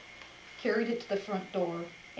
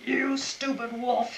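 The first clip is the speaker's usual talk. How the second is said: loud, slow, higher angry holler; 5 semitones higher, 6 dB louder, slower than usual. A narrator's reading. Grumble Bunny insulting the wolf, loud holler. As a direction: yell the insult up at him. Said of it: "he hollered".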